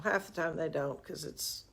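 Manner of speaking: normal talking voice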